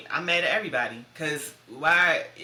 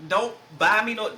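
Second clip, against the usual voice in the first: firm instruction; 5 semitones higher, 6 dB louder than usual. Retold or imitated. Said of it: "I tell my girlfriends".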